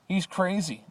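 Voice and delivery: high frightened cry; shouted. A narrator's reading. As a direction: call it out in alarm. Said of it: "they shouted".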